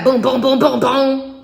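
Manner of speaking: high, punchy booms in a row; imitated rapid booms. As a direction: punch each boom out like quick hits. Imitating events happening one after another.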